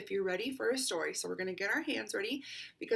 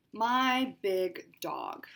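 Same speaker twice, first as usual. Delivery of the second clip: slow, drawn-out book title; title read slowly; 7 dB louder, much slower than usual. Acted. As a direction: announce the title with relish.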